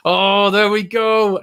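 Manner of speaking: high, loud, drawn-out excited call; excited cheer. Real, not acted. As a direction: cheer as the build-up kicks in. Build-up to kick-off starts on screen.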